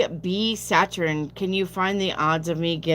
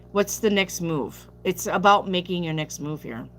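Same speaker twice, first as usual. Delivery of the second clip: ordinary talk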